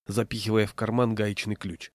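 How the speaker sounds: ordinary delivery, nothing marked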